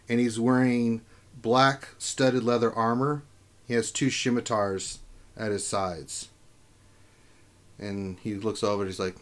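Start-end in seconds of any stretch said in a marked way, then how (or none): none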